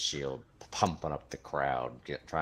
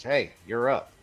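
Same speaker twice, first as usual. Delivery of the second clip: guard calling out; 7 semitones higher, 10 dB louder than usual. Acted. Guard calls the fighter.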